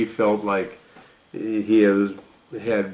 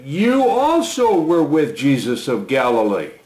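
raised pitch, earnest reading of Peter's vow; scripture reading; 4 semitones higher than usual. Acted. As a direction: lift the voice to read Peter's bold promise. Reading the denial passage.

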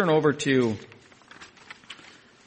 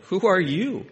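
Isaac asking; 7 semitones higher, 5 dB louder than usual. Acted. Isaac questions his son.

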